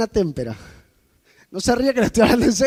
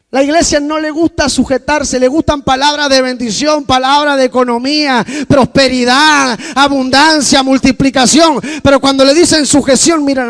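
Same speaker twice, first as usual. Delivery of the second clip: preaching at full force; 3 semitones higher, 8 dB louder than usual. Acted. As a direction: thunder it at a careless church.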